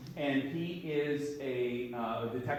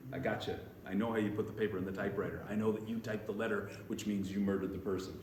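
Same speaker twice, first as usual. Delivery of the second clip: low, softer, quick accusation; gotcha; 6 semitones lower, 6 dB quieter, faster than usual. Retold or imitated. Detective confronting the murderer, low gotcha delivery. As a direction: lay out the proof quietly and rapidly. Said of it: "the gotcha moment".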